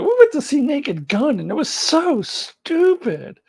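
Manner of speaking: high-pitched retelling, stretched at the end; imitating Australian girls. Recalling exchange students' film review, high mock-disdainful imitation. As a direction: mimic the visitors high, dragging out the verdict. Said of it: "all I remember is them going".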